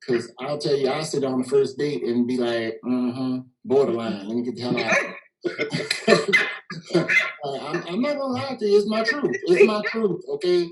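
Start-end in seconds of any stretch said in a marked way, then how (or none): none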